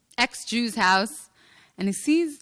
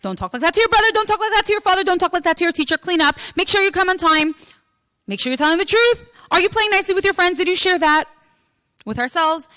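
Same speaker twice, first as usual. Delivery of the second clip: loud, raised string of parental orders; imitating a nagging parent, pitched up and insistent; nagging commands; 5 semitones higher, 7 dB louder, faster than usual. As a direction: fire off one order after another. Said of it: "issuing a string of about a million commands".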